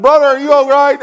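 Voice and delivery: high, loud concerned call; worried friend. Retold or imitated. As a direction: rush in checking on him. Brother Charlie runs in worried.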